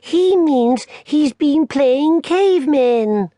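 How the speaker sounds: put-on high, loud, drawn-out teasing girl voice; a big sister mocking kindly. Lou teases her little brother Barney, high loud teasing girl voice. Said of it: "Lou exclaimed helpfully".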